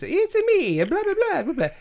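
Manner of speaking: high, bright Italian plumber impression; Mario voice. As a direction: squeak it out like the cartoon plumber. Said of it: "they do that voice".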